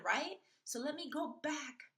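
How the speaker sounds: usual speaking voice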